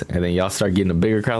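usual speaking voice

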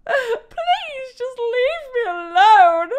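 high, loud, slow mock whine; whiny plea. Real, not acted. Player begging a zombie, slow whiny plea. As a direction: beg the zombie to go away.

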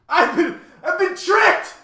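very high, loud, outraged wail; helpless cry. A narrator's reading. Math magician outwitted by Milo, helpless high cry. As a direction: cry out at being beaten. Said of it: "cried the math magician helplessly".

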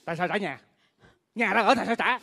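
loud protest. Acted. Stepsister protesting to her family, loud indignant question.

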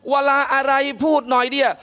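ordinary delivery

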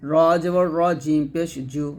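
usual speaking voice

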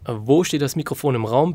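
ordinary talk

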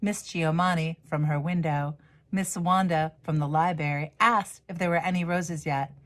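ordinary delivery, nothing marked

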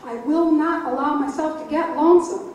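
emphatic and loud, declaring it outright; firm proclamation. Real, not acted. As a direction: declare it proudly to the microphone. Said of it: "She proclaims this directly into the microphone".

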